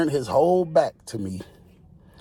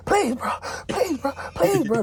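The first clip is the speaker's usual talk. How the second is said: pitched way up, far-off begging; mock high pleading. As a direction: beg in a high whimpering voice. High man begging officer.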